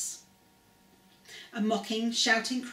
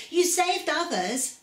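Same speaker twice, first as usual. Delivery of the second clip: jeering, louder and higher; mocking; 7 semitones higher, 4 dB louder, slower than usual. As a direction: sneer it up at him. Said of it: "they said mockingly".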